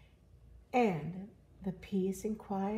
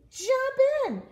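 high, loud holler; big, high-pitched animal cheer; excited calling out; 9 dB louder than usual. Acted. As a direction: shout an eager invitation. Animals urge others into pond.

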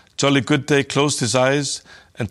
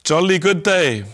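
crying out; 5 semitones higher, 3 dB louder than usual. A narrator's reading. Hulda calls Jolly Good Day out of his trance, crying out. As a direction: call out a name to get attention, pitch raised. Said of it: "Hulda cried out".

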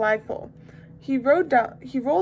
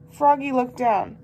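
ordinary delivery

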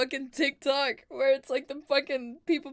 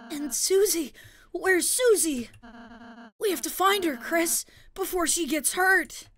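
higher, anxious character voice; worried game character; 6 semitones higher than usual. Acted. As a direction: plead with worry for a missing friend.

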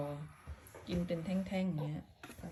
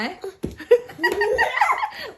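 high loud laugh; 14 dB louder than usual. Real, not acted. Laughing at dizzy spinning child.

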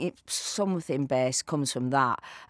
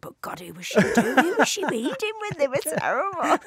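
high, scornful put-on voice; mock a snooty casting director; scoffing casting director; 12 semitones higher, 5 dB louder than usual. Imagined casting verdict.

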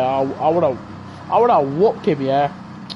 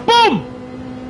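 triumphant shout; 12 semitones higher than usual. Real, not acted. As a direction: shout it like you just nailed the pass. Celebrating overtake.

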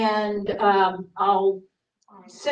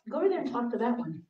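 quiet nudge; 8 dB quieter, faster than usual. Retold or imitated.

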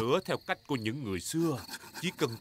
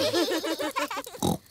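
squeaky high chuckle; proud little giggle; high laugh. Acted. Daddy Pig giggling at his own boast, high squeak.

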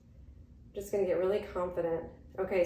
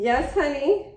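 yelling across the room; 7 semitones higher, 7 dB louder than usual. Real, not acted. Answering son calling from downstairs.

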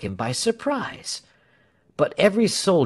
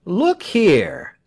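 loud, higher indignant protest; indignant raised voice; angry; 6 semitones higher, 6 dB louder than usual. A narrator's reading. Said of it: "Then he was angry".

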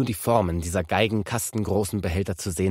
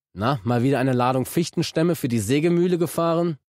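nasty mockery; 6 semitones higher, 4 dB louder than usual. A narrator's reading. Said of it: "lachte ich den Sattelschlepperfahrer böse an".

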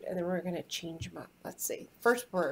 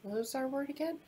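ordinary talk

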